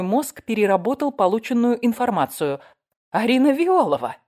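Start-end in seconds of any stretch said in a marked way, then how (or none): 3.1-4.2 loud, high cry of sudden realization; excited narrator's outcry; acted yell. A narrator's reading. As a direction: burst out in startled recognition. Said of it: "заорала я".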